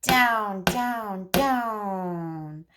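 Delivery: put-on speech therapist modeling turn-taking for a child, sing-song. Shown as a demonstration. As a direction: model it slowly and playfully for a toddler. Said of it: "So you could say".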